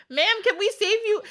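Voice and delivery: giggling through a rescuer's question; laughing mock rescue. Host mocks the rescuers asking the wife, laughing. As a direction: ask the trapped wife while cracking up.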